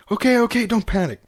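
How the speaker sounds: high, flustered reassurance; trying to stay calm but panicking; husband fluttering with nerves; panicky. A narrator's reading. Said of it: "said panically".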